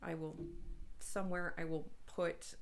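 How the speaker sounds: normal talking voice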